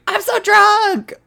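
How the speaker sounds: put-on high, slow drunken shriek; drunken scream. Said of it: "you just hear her scream".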